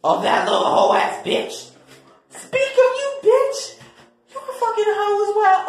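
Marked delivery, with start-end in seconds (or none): 2.3-5.6 high character voice. Acted. A new character voice cuts in.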